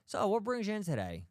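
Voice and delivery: mock clinician greeting. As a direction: imitate a clinician's stock opening question. Mimics unprepared clinician greeting patient.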